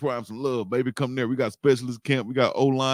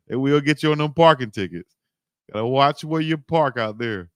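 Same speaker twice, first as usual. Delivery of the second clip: ordinary talk, nothing marked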